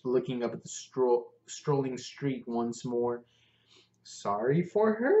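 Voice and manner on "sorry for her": rising question. Acted. Lin guessing, questioning.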